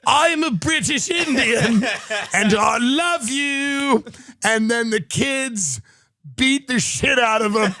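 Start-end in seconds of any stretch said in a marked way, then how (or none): 0.1-4.0 slow, raised, hammed-up British accent; Nathan Phillips. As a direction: proclaim it grandly in a fake British accent. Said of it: "with his drum and his British accent".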